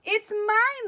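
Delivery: high, bright-pitched chirp of a claim; twittering bird. Acted. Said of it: "the bird twittered".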